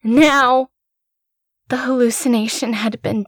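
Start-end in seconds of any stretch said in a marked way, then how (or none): 0.0-0.7 sharp loud order; commanding shout. A narrator's reading. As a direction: order the child away firmly. Mother ordering child away.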